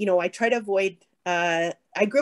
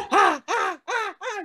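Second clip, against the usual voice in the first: mock panicked ah-ahs climbing much higher; escalating panic cries; 11 semitones higher, slower than usual. Parent mimicking a freak-out spiral with a kid, panicky rising cries. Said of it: "your kid is freaking out and you start to freak out".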